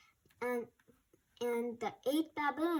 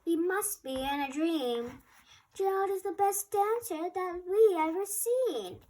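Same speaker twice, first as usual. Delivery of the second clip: higher, louder cheer of wonder; shouting; 7 semitones higher, 5 dB louder than usual. Acted. Animal cheering Gerald's dancing, excited shout. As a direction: call it out to the whole crowd. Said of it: "He shouted".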